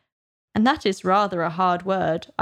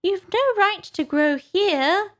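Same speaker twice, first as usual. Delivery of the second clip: very high, slow Dormouse; high-pitched complaining little creature; Dormouse scolding; 12 semitones higher, slower than usual. A narrator's reading. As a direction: object indignantly up high as the Dormouse.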